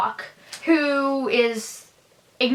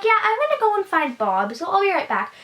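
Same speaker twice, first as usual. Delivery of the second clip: high-pitched, quicker imitation of a woman excusing herself; Nancy; 7 semitones higher, faster than usual. Nancy telling the men she's off to find Bob, high and quick. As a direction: lift the voice high and rattle it off. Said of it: "Nancy's like".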